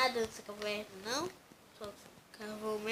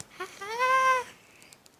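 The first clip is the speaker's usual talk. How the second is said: sudden high-pitched laugh burst; loud high laugh; 12 dB louder than usual. Real, not acted.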